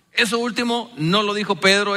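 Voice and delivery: normal talking voice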